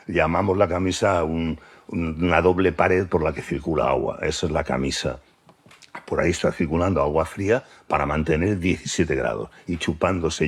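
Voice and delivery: ordinary delivery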